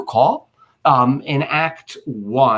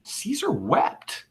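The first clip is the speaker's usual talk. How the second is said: Caesar wept drawn out slow and soft, a little higher; 8 semitones higher, 7 dB quieter, much slower than usual. Shown as a demonstration. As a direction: let the words fall slowly and softly. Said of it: "When that the poor cried".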